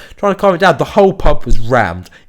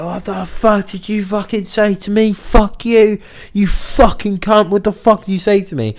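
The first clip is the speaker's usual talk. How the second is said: angry confrontation; 9 semitones higher than usual. Retold or imitated. Woman runs across the restaurant to confront staff, mock furious. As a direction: imitate a furious woman demanding an answer.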